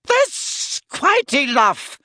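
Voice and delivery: high, slow, sharp reproof; stern telling-off in a high lady's voice. A narrator's reading. Shop owner stepping into a quarrel, sharp. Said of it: "said Madame Malkin sharply".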